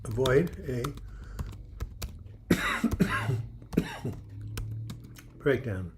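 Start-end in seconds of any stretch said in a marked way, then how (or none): 0.9-5.1 long dry coughing fit mid-sentence; a dry throat cuts the sentence off with a cough; real coughing fit. Real, not acted. Host speaking to viewers stops for real dry coughing. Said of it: "I just have a dry throat".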